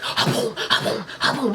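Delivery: harsh, breath-heavy imitated basket slamming; forceful mimicked "ha boom" slams; violent shaking noise. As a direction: imitate fries being shaken far too hard. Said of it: "He was shaking it so hard".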